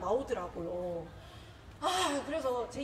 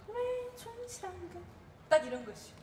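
mom's retort; 8 semitones higher, 4 dB quieter than usual. Retold or imitated. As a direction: imitate mom asking softly, pitched way up. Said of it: "근데 우리 엄마가 옆에서".

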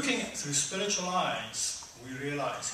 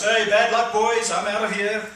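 loud, cocky, higher gloat; gloating Barabbas; 6 semitones higher, 11 dB louder than usual. Retold or imitated. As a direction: taunt them on the way out. Barabbas leaving the cell.

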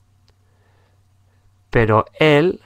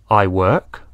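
I work said plainly; model; 3 semitones lower, 4 dB louder than usual. Shown as a demonstration. Teacher contrasts the verb forms for learners, plain. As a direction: contrast base form. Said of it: "añadimos una S al verbo".

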